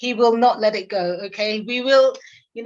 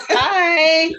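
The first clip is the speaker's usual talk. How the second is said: laughing; 8 semitones higher, 4 dB louder than usual. Real, not acted. Laughing greeting to sister's family.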